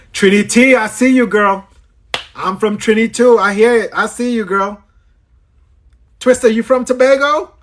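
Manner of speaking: high, delighted shout-out; light up on finding someone from your island, pitched well up; excited greeting. Real, not acted. Host greeting a girl from Trinity too, thrilled.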